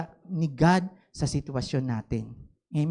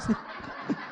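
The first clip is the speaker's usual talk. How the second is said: breathy laugh; 10 dB quieter than usual. Real, not acted. Laughs mid-story.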